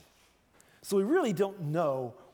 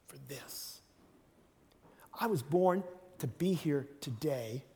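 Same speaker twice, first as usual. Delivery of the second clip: calm and sincere; 3 semitones lower than usual. Shown as a demonstration. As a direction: tell it gently and humbly. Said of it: "I think Jesus was saying".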